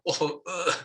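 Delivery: playful little panicked reaction; mock panic. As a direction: act out a small panic at a dirty splash. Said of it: "I get panic".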